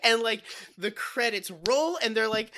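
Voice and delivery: normal talking voice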